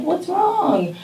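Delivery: put-on older stranger asking the kid gently; kind older man. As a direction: ask softly what's the matter. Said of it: "he's like".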